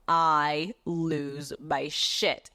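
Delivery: very slow, drawn-out words; dramatic slow-down. Real, not acted. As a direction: stretch each word for dramatic weight. Drawn-out climax of the story.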